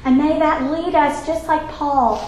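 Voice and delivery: soft, hushed prayer voice; closing prayer. Acted. Teacher closes the ladies' class in prayer, hushed. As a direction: pray it quietly and earnestly.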